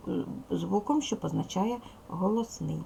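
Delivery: normal talking voice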